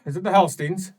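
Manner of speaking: loud demand. Acted. Graf demanding whether the Hellsteins are behind it, loud. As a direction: bark the question at him.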